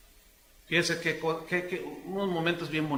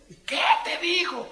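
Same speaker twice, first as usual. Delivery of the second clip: put-on higher, excited girl voice; eager daughter; 10 semitones higher, 5 dB louder than usual. Daughter asks her father eagerly about the proposal. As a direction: lightly voice the curious daughter.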